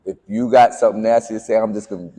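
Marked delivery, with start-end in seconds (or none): none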